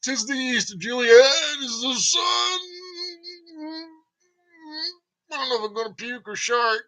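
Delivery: high mock Romeo cry, breaking into a shout; hammed-up Shakespeare. Nervous actor botching Romeo onstage, high theatrical shout. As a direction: declaim the balcony line like a terrified amateur. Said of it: "all of a sudden you're like".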